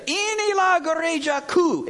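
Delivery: "Inilah gereja ku" said high and slow, "ku" weighted; proud pastor claiming the church, hitting "ku"; stress on ku. Retold or imitated. Indonesian pastor boasting to preacher, possessive stress on ku. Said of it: "emphasis on ku".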